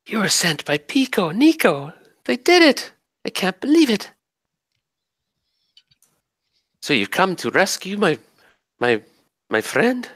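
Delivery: very high, thrilled spirit voice; overjoyed fairy. Acted. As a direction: burst with disbelieving joy, way up high.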